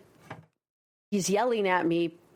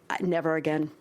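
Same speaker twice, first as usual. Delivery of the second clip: dropped voice; 7 semitones lower than usual. Retold or imitated. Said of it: "that's when my voice dropped".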